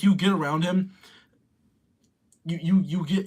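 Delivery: usual speaking voice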